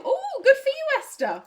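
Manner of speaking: excited. Acted. Reacting to letter's news.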